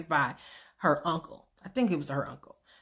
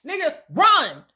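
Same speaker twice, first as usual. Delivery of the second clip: urgent warning; 7 semitones higher, 5 dB louder, slower than usual. Retold or imitated. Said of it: "but I'm like".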